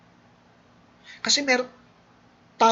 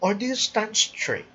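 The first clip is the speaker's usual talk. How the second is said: higher, straighter delivery; sit up and say it tall; a proud standing-tall read; upright, standing tall; 7 semitones higher than usual. Shown as a demonstration.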